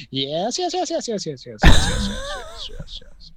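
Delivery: jumpy squeaky toy robot yeses; toy helicopter voice. Retold or imitated. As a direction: mimic a lowering toy helicopter. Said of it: "and it went".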